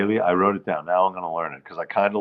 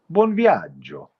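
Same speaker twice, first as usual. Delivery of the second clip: Italian; slower than usual. Retold or imitated. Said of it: "so I said".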